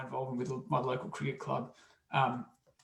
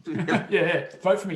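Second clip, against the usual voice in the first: loud, higher, through laughter; laughing mock pitch; 5 semitones higher, 10 dB louder, slower than usual. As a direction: play along with the joke, laughing out loud. Plays along with mock vote-buying joke.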